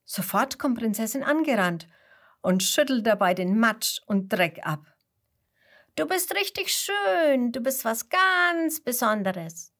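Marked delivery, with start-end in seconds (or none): 6.0-9.7 high cheering flock voices; shower her with admiring praise; sheep calling praise. A narrator's reading. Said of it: "rufen ihm die anderen Schafe zu". (hohe, bewundernde Schafstimmen)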